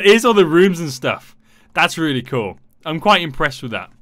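usual speaking voice

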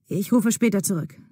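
usual speaking voice

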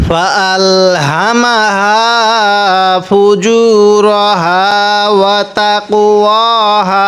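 slow, drawn-out tajweed chant; Quran recitation. Shown as a demonstration. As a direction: recite each word slowly with long vowels. Teacher recites verse after spelling.

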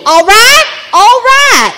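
high, loud Southern check-in; emphatic Southern "all right", pitched high; Miss Doreen. Acted. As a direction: demand agreement like a no-nonsense Southern woman. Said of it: "Her name is, uh, Miss Doreen".

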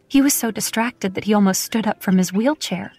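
ordinary delivery

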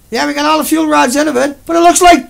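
sarcastic spokesman voice rising high and loud on the end; mock company spokesman. Mocking TEPCO to viewers, a high, loud sarcastic spokesman. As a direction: ham up the official line, then squeak it out.

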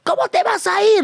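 high-pitched mimicked protest; typical protesting friend. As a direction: imitate a friend begging you to stay. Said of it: "La típica".

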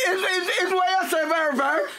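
a husband mock-wailing at his wife's joke; wailing outcry. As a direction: cry out in mock hurt at his wife.

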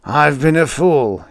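slow, loud, high raving. A narrator's reading. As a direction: rave it out slowly and loudly. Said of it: "he raved".

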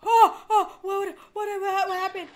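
high, loud stammering alarm; startled panic. Real, not acted. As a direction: stammer it out in shock. Startled by game event.

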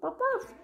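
very high, soft little woofs; dog woof. Pretend. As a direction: yip softly like a tiny dog. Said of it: "The dog says woof".

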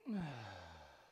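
long audible sigh out; demonstrated exhale. Shown as a demonstration. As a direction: breathe out audibly in a falling sigh. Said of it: "and exhale".

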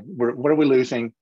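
usual speaking voice

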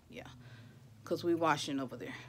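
ordinary delivery

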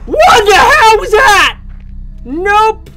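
loud high startled cry; real fright; startled scared. Real, not acted. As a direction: react to a sudden scare. Jump scare in horror game.

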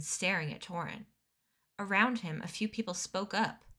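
usual speaking voice

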